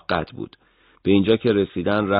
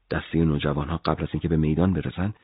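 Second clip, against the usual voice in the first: plain reading voice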